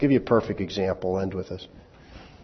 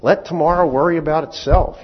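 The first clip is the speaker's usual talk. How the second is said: tongue-in-cheek; 6 semitones higher, 5 dB louder than usual. Shown as a demonstration. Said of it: "It's tongue-in-cheek humor".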